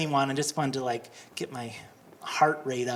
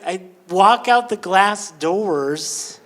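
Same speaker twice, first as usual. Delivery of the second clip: usual speaking voice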